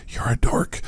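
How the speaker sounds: unvoiced whisper of a teasing jab; whispered aside. Vlogger whispering an insult to esports fans.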